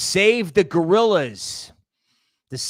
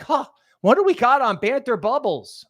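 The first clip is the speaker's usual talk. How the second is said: high, loud mock eager voice; hammed-up shopper voice; imitating Toby; 7 semitones higher, 5 dB louder than usual. Mocking his cohost shopping the dip. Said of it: "It's like".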